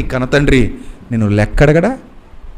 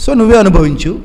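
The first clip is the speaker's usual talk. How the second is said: stern verdict; 6 semitones higher than usual. Retold or imitated. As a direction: pronounce the sentence like a stern judge. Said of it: "అన్నాడు".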